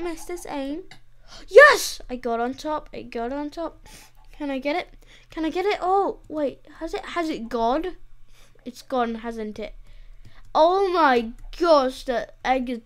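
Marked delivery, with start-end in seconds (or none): 1.5-2.0 high, loud yes; sudden jubilant yell; triumphant cheer. Real, not acted. Succeeds in getting on top. 10.5-12.0 loud, drawn-out exclamation; slow, loud cry of frustration; exasperated disbelief. Real, not acted. Egg has despawned.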